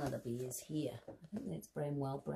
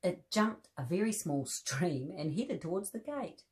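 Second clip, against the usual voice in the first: gentle storybook reading with voiced bears; reading to children; 5 dB louder than usual. A narrator's reading. Presenter reading a teddy bear story to children, gentle storybook voice. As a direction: read the story aloud to little listeners.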